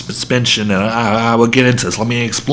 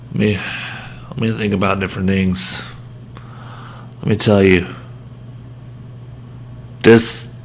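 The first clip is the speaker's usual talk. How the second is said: softer, deflated after the rant; calming down; 3 semitones lower, 6 dB quieter than usual. Real, not acted. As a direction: wind down, drained and tired. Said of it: "I'm getting really heated... I need to cool down".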